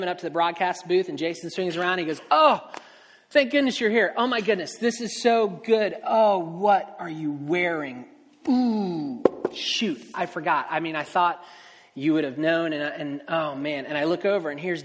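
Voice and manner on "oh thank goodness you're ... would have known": excited relief. Retold or imitated. Coworker Jason greeting the late color commentator, gushing with relief. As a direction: gush with relief, then realize the outfit problem.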